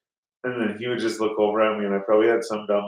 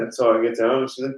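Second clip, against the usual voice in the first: ordinary talk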